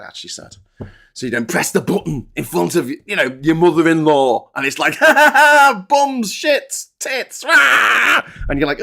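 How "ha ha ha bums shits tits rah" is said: high, loud cackling jeer of rude words; rowdy voice note shout. Retold or imitated. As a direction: yell it like a mate's crude prank message. Rude voice note played aloud.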